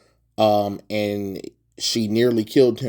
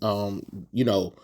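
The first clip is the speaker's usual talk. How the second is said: ordinary talk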